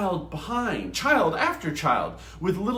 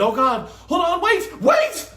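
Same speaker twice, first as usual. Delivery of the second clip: rising to a high, loud panic; louder and higher on each wait; frantic cry; 13 semitones higher, 7 dB louder than usual. A narrator's reading. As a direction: Rube yelling as the car swerves. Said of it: "and Rube said".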